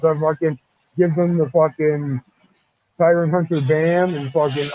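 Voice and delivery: ordinary delivery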